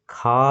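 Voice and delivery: খা held long on its vowel; one sustained খা; drawn-out vowel. Shown as a demonstration. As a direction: stretch the "aa" of খা right out. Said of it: "লম্বা করে".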